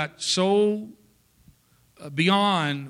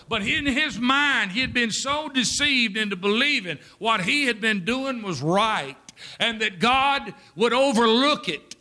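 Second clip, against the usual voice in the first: rising preacher's fervor, pitched up; preaching; 6 semitones higher than usual. Acted. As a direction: build the sermon's urgency line by line. Preaching build-up.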